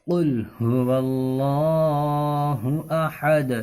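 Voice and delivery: very slow, melodic tajweed chant; Quranic recitation. Acted. Teacher recites the lesson verses, slowly chanted. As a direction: stretch every vowel in recitation.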